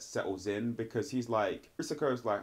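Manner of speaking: ordinary talk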